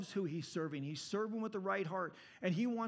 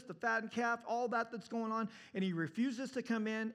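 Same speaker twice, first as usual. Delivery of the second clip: normal talking voice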